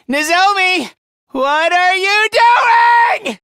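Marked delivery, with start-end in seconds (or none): 1.3-3.4 drawn-out shout, rising high; horrified shout. Acted. Demanding what Nozomi is doing, horrified shout. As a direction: stretch it into an anguished yell.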